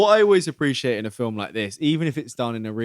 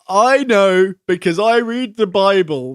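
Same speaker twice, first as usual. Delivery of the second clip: loud, higher, slowed-down mock retort; emphatic retort; 6 semitones higher, 9 dB louder, slower than usual. Bible girl arguing back at the curly-haired girl, mock loud retort. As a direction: imitate her insisting, loud and deliberate.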